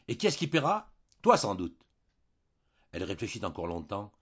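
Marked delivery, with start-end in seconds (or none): 0.1-1.9 sharp, much higher and louder retort; angry. A narrator's reading. Husband cutting off his wife about who pays, angry. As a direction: cut her off mid-sentence. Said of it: "Il ne la laissa pas achever".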